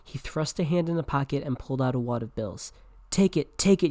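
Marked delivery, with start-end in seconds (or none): 3.1-3.9 higher, mildly frantic plea; understated cry of surrender. Acted. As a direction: urge it as if desperate to be rid of him. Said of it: "he cried".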